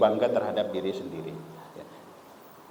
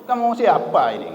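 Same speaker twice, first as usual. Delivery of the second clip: mock Madurese accent, a bit higher and louder; 6 semitones higher, 5 dB louder than usual. Penumpang kasar menegur pemilik kursi dengan logat Madura. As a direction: Madurese villager questioning the stranger. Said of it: "Dengan logat Madurnya".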